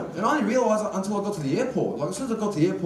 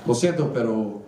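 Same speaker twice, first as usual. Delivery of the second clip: mock Mexican Spanish accent, pitched low; hammed-up Mexican Spanish, feigning no English; full Mexican; 3 semitones lower than usual. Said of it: "I went full Mexican".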